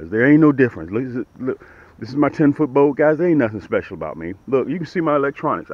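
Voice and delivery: ordinary delivery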